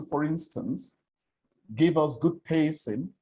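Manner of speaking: ordinary talk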